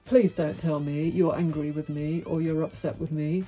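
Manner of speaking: ordinary talk, nothing marked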